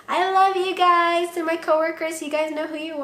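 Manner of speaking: heartfelt. Real, not acted. Thanking viewers and coworkers.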